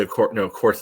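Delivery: ordinary talk, nothing marked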